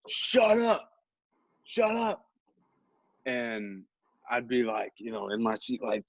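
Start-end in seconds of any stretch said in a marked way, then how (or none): 0.1-2.3 high-pitched mock yelling; imitated yell. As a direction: yell it high, like a fed-up kid, without full volume. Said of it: "around him that would yell".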